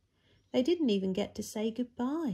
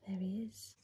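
soft breathless whisper; awed whisper; 7 dB quieter than usual. A narrator's reading. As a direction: hush it like a wondrous secret. Quiet reveal of risen Lazarus.

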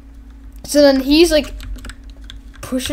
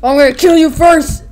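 loud, raised-pitch acted threat; hammed-up game character yell; mock threat; 6 semitones higher, 10 dB louder than usual. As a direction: call out a playful threat. Voices Heavy threatening.

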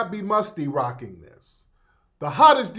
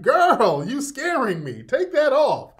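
put-on clowning kid; 8 semitones higher, 4 dB louder, slower than usual. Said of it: "Even the kids are clowning".

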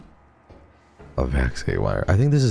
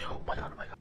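whisper; 16 dB quieter, much faster than usual. Real, not acted. Tense escape moment.

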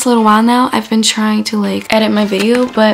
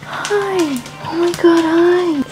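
dog greeting; 8 semitones higher, much slower than usual. Real, not acted. Girl greeting her dog in the kitchen, high baby talk. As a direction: coo at the pet, high and sweet.

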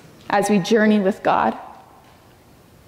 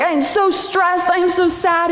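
put-on stressed teen whine; 8 semitones higher than usual.

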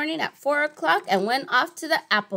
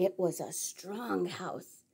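hushed aside; 6 semitones lower, 15 dB quieter, slower than usual. A narrator's reading.

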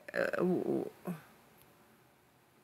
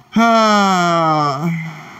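loud fed-up sigh; 4 dB louder than usual. Real, not acted.

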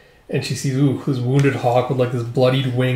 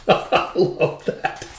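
laughing; 3 semitones higher, 5 dB quieter, much slower than usual. Real, not acted.

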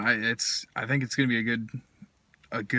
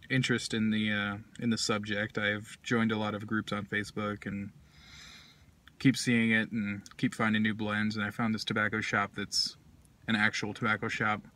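speaking while smoking pipe; talking around a pipe. Real, not acted. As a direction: pipe clenched between lips. Seen 1: Curved pipe held between lips most of the stretch, removed briefly ~15s.

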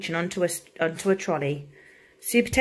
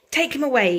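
higher, louder hero's command; commanding order; 6 semitones higher, 5 dB louder than usual. A narrator's reading. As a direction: order it out with raised voice. Hero gives an order.

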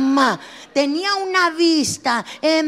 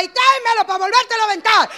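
high, fast, loud mock yell; imitating mom's taunting shout; mother yelling; 8 semitones higher, 5 dB louder, faster than usual. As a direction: yell it like an angry mom throwing sandals. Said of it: "me gritaba".

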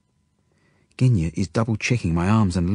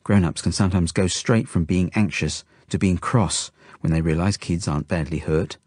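usual speaking voice

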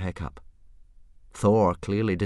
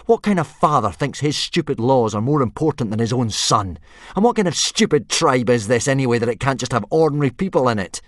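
put-on higher, louder and fast furious boy; boy shouting at his father in anger; angry boy; 6 semitones higher, 8 dB louder than usual. A narrator's reading. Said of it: "Hiccup was very angry".

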